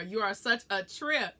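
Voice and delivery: usual speaking voice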